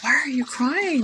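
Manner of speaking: lightly voiced woman asking why; retold question. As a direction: ask it gently as a puzzled question. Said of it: "like a question".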